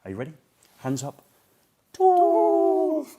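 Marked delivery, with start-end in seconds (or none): 1.9-3.1 loud tool call. Shown as a demonstration. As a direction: call it up to the ceiling, long and high. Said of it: "you're gonna hear this call".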